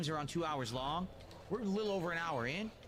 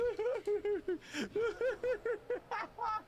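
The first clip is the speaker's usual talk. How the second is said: high laugh. Real, not acted. Streamer cracks up at the ruined touching moment, laughing high. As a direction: burst of high-pitched laughter.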